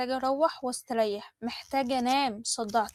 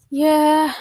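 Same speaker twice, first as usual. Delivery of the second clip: satisfied exclaim; 3 semitones higher, 9 dB louder, much slower than usual. Acted. Mariam relieved after big meal.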